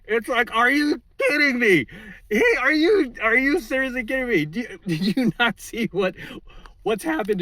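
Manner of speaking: high, loud, slowed disbelief; incredulous yell. Real, not acted. Vlogger ranting at his opponents, loud incredulous yell. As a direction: shout it out in exasperated disbelief.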